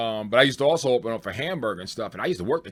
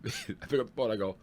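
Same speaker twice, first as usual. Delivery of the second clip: usual speaking voice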